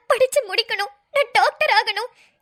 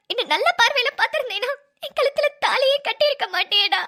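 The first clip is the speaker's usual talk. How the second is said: usual speaking voice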